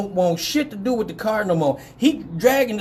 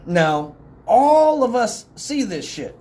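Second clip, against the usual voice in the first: ordinary talk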